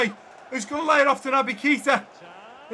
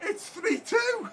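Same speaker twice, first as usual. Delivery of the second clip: higher and slow, half laughing, half crying; laughing with joy; 9 semitones higher, 5 dB quieter, slower than usual. Real, not acted. Retiring manager after the winner, laughing with joy. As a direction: giggle the score through happy tears. Overjoyed after go-ahead goal.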